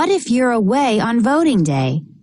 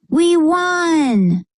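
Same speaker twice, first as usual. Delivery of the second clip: excited cheer; 7 semitones higher than usual. Acted. Family celebrates mom's election win.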